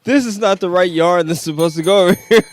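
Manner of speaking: very high, very loud comic screech; mock irate customer. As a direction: shriek it like a hammed-up complaining shopper. Acts a complaining craft-store customer.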